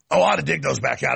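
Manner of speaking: ordinary talk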